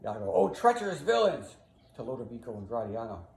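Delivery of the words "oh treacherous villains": high, loud exclamation; feigned outrage. Acted. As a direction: denounce the attackers theatrically. Iago feigns outrage at attackers.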